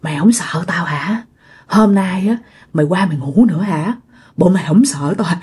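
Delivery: low, half-whispered menacing questions; taunting ghost. Retold or imitated. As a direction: taunt the victim in a low murmur. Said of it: "Cái kêu".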